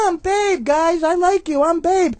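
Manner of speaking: high, squeaky little pig voice; Babe pig voice. Retold or imitated. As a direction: chant it like a coked-up piglet rehearsing. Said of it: "And I'm going".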